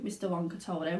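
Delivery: usual speaking voice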